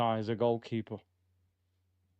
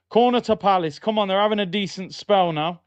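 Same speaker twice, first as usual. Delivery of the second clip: loud, raised-pitch fan commentary; excited match call; 6 semitones higher, 7 dB louder than usual. Real, not acted. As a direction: call out the corner, urging the team on. Reacting to live match corner.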